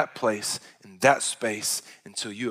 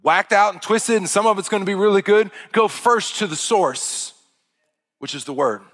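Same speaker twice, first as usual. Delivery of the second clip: high, loud, driving sermon delivery; full-force preaching; 9 semitones higher, 9 dB louder than usual. Acted. Preacher urging the congregation to go to God and pray, full-force preaching. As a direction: preach it hard, each phrase pitched high and punched.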